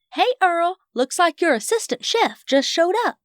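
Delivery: high, cheerful call across the kitchen; high-pitched happy holler; delighted greeting. A narrator's reading. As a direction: announce a welcome arrival, pitched high. Bobby Sue greets the boy's arrival.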